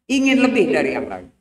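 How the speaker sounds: fast thundering sermon line; fiery preaching. Acted. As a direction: rattle it off in a preaching burst. Preacher at full force.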